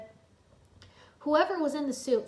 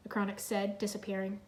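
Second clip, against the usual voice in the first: plain reading voice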